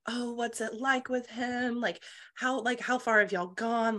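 lightly voiced prying court lady; nosy gossip voice. Gossiping ladies question her.

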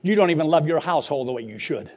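ordinary talk

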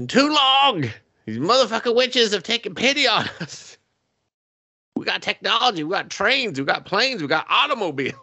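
high, hammed-up ranting king; high mock rally speech from the king; mock king's speech. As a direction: rant like a pompous villain king.

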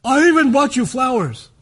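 loud, high mock-angry outburst; horrible, aggressive giving. Husband giving his wife flowers horribly, mock-aggressive. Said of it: "the way you did it was horrible".

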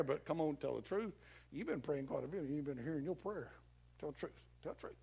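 low, hushed prodding; coaxing devil. Acted. Devil nudging a believer to admit doubt, low and coaxing. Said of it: "He says".

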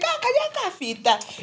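high-pitched mimicry of relatives; mock the family remark, high and sing-out; teasing relatives. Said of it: "that type of thing".

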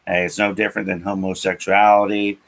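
ordinary talk, nothing marked

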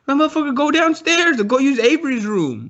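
high-pitched indignant comeback; mock exasperation. He sends the stranger downstairs, mock exasperation. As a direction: reenact an exasperated telling-off. Retold argument through the door.

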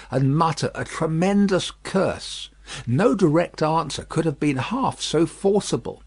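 plain reading voice

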